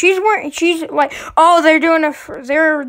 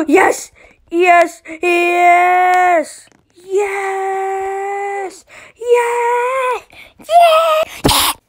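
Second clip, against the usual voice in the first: excited cheering; 7 semitones higher, much slower than usual. Real, not acted. Player cheering as the giant saves him. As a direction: celebrate every yes as you climb.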